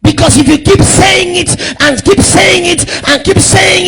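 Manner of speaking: loud, high, sing-song sermon cadence; full-force preaching; fiery preaching. Real, not acted. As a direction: rouse the congregation to action.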